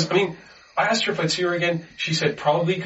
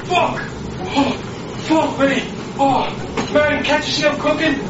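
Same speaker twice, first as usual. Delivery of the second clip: shouting over engine; 6 semitones higher, slower than usual. Acted. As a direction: bellow over the cooking noise. Shouting over cooking noise.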